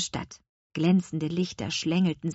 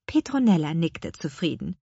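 ordinary delivery

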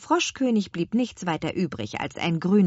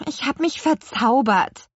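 higher, louder, cross confession; grumpy admission; 8 semitones higher, 5 dB louder than usual. A narrator's reading. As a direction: admit it grudgingly. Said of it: "gab Petronella missmutig zu". (missmutig zugegeben)

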